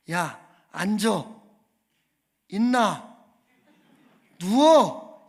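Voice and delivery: loud, separate one-word commands; mock army orders, loudest on the last word; army senior's orders. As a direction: imitate a drill order, with long gaps between commands. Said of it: "이렇게 이야기를 하는 겁니다".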